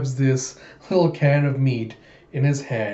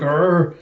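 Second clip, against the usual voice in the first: growl; 4 semitones higher, 5 dB louder than usual. Acted. Brawler growls a challenge.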